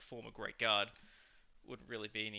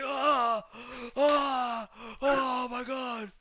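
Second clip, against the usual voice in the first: high, strained pained cry; hammed-up choked gasp; mock choked wail; 10 semitones higher, much slower than usual. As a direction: whine like he's being strangled. Choked guy tapping out.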